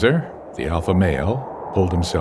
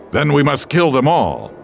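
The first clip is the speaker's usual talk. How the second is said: loud, higher fierce threat; angry; 10 semitones higher, 6 dB louder than usual. Acted. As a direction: snarl out a call to kill. Wolf leaps up, bares teeth.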